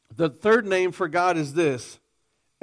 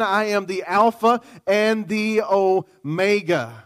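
ringing, slightly raised preaching voice; preaching at full force; 3 semitones higher, 4 dB louder than usual. Acted. Preacher voicing God's claims to the congregation, fervently. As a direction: proclaim it with full conviction.

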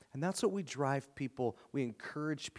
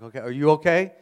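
loud, higher checking question; imitated baffled neighbor; 4 semitones higher, 13 dB louder, faster than usual. Neighbor checking on the traveling preacher, loudly baffled. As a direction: act out a neighbor doubting someone's sanity.

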